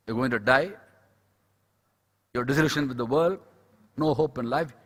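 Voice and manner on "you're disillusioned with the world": normal talking voice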